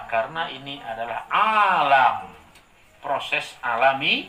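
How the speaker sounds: preacher hammering home a point, slow and loud; slow emphatic preaching. Real, not acted. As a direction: lean on every word. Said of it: "preaching emphasis".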